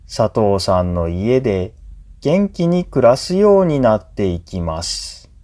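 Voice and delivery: normal talking voice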